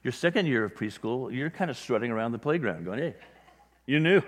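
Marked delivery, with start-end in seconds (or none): 3.0-4.2 cocky preschooler. Retold or imitated. Preschooler strutting on the playground.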